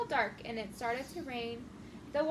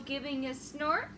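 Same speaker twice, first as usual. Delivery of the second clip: ordinary delivery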